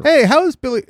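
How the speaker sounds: high, chirpy fake-friendly "Hey"; mock showbiz greeting. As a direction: greet Billy like an old pal, hamming it up.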